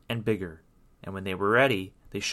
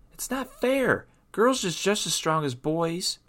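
put-on high-pitched girlish complaint; indignant mermaid girl; indignant protest; 9 semitones higher than usual. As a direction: protest high, stung by unfairness. Rita protests the king's refusal.

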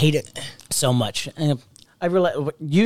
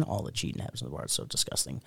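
whisper; 11 semitones lower, 15 dB quieter than usual. Real, not acted. Aside about bar cheating.

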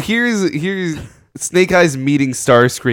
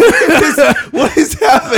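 high, laughing what is happening; laughing disbelief; 12 semitones higher than usual. Real, not acted. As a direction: laugh through the question.

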